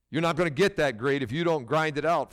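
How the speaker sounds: ordinary delivery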